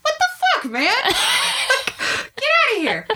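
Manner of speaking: hammed-up outrage, shrieking with laughter; mock outraged yell. Comedian retelling her outburst at ex-boyfriend, a laughing mock yell.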